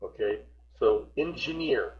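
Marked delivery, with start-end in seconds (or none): 1.2-2.0 "engineer" with the weight on its final syllable, -neer; stress on last syllable. Shown as a demonstration. Teacher correcting a student's word, "engineer" stressed on the end. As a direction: model "engineer", landing the accent on the last syllable. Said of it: "that stress goes on the last syllable".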